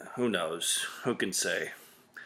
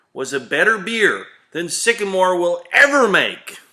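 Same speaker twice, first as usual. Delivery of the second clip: indignant rant; 7 semitones higher, 6 dB louder than usual. Acted. Rant about the brand-chasing customer.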